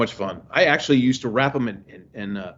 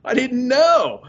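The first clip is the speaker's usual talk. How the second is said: slow amazed; 10 semitones higher, 3 dB louder, much slower than usual. Retold or imitated. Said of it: "I was like".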